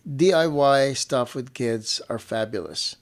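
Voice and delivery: normal talking voice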